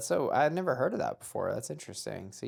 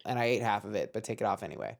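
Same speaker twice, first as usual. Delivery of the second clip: usual speaking voice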